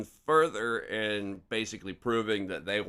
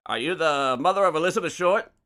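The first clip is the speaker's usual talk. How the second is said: raised, loud Mid-Atlantic newsman voice; Mid-Atlantic accent; 6 semitones higher, 8 dB louder, faster than usual. Acted. Reporter checking he has the mother, louder Mid-Atlantic accent. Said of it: "a pretty good Mid-Atlantic accent".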